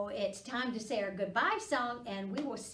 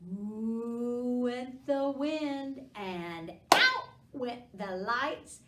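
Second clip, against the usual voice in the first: ooh like a howling wind; spooky wind; 3 semitones higher, 6 dB louder, slower than usual. Acted. Teacher making the wind for kids, spooky and louder. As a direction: let the wind blow through the words.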